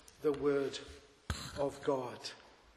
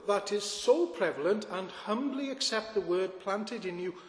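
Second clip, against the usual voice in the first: rising, louder sermon emphasis; preaching; 3 semitones higher, 5 dB louder than usual. Acted. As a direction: preach it with building force. Preaching at full force.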